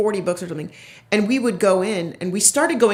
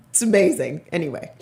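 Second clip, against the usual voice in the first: ordinary talk, nothing marked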